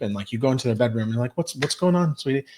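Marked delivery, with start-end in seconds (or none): 1.4-2.4 gentle parent. Retold or imitated. Parent comes into the bedroom to a crying kid, gently. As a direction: ask it like a kind parent at the bedside.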